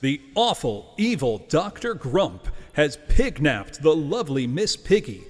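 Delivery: movie announcer voice. Acted. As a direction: sell it like a big movie trailer. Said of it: "I will use my best movie announcer voice here".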